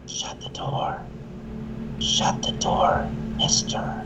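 breathy ghostly whisper of the recording. Shown as a demonstration. Replaying the ghost's words from the camera in a whisper. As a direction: breathe it out like the voice caught on tape. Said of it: "Just like that".

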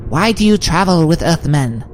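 high, put-on guard's voice; high-pitched creature. A narrator's reading. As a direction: challenge strangers in a high creature voice. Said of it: "unfamiliar, high-pitched voice".

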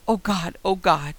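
loud panicked cry of Oh God; terrified cry. Acted. Narrator crying out as something hits the car, terrified shout. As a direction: shout it in sudden terror. Car hit by massive thud.

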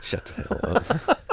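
half-laughing whispered brush-off; whispered joke. Real, not acted. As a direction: hiss it under your breath, teasing. Playful dismissal of friend.

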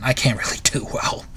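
a sheepish whisper. Real, not acted. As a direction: whisper it as an aside. Embarrassed aside after duck impression.

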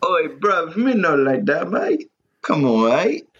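high hammed-up London slang voice; mock London accent. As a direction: bark it like a cartoon London lad.

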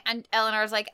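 ordinary talk

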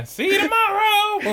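high, slow squeaky goodbye; mock farewell. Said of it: "mimicking the woman".

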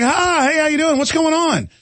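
high, sing-song overly friendly hello; bright forced politeness; mock friendly greeting. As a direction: sweeten it up to charm the cop. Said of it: "And I was like".

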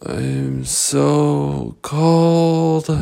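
very slow, drawn-out frozen words; freezing. Acted. Captain Hook pulled from the snow, acting frozen. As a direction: drag each word out as if frozen stiff. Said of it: "so cold he could barely move".

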